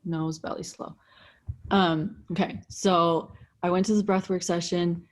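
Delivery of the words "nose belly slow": fading repeat. Shown as a demonstration. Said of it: "the top three most important thing".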